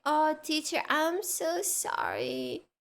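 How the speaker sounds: put-on slow, pleading little kid; sweet kid apology. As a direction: draw it out like a sorry child.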